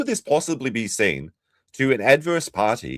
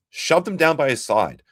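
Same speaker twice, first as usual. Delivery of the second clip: ordinary delivery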